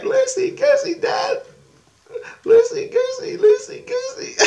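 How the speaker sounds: very high squealed chant; shrieky little-kid chanting; mimicked excited kid. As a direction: squeal it to Dad like a thrilled child. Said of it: "She comes running back".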